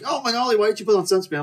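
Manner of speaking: high-pitched nagging reproach; mock scolding. Companion scolds the sunburnt swimmer, mock scolding.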